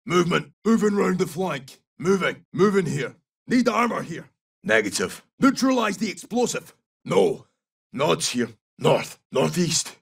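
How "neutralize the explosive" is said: ordinary delivery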